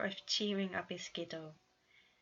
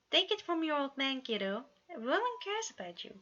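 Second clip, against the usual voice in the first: fatherly reassurance; 7 semitones higher, 3 dB louder than usual. A narrator's reading.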